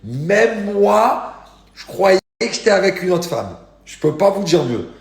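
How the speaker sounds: loud, high-pitched exclaiming; stretched, emphatic hype at full voice; over-the-top amazement. Real, not acted. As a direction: shout it like you cannot believe it. Hyping an unbelievable lookalike photo.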